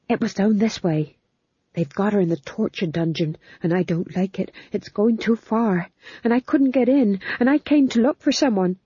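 a small man upset and pleading; anxious fool. Acted. The fool tells Magrat about the dungeon, high and anxious.

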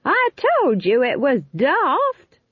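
high, drawn-out scolding shout; slow, high-pitched yell. A narrator's reading. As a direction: shout a told-you-so across the wind. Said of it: "she shouted".

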